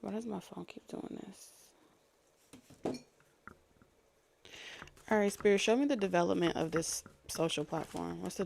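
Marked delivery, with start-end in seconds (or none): 0.0-3.0 much softer, slow muttered aside; muttering. Real, not acted. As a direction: grumble it slowly under breath. Muttering at phone to self.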